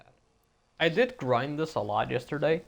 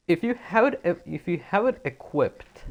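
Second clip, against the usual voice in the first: real stutter, restarting the phrase higher; 6 semitones higher than usual. Real, not acted. Streamer explaining a game bug to viewers, stuttering. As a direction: stumble over the opening words and begin afresh. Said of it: "I'm sorry I'm stuttering so badly today".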